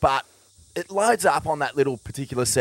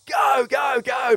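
much higher, shouted go go go; imitating a friend screaming from the water; retold scream; 12 semitones higher, slower than usual. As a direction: yell it like urging a surfer onto a wave.

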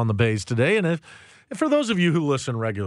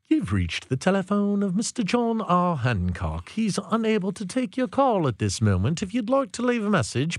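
voicemail announcer; 7 semitones higher than usual. Pretend. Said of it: "It would be like".

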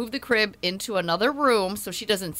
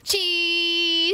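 high, drawn-out "cheese" for the camera; photo call; 7 semitones higher than usual. Real, not acted. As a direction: sing out one long high cheer for a picture. Calling out for a photo.

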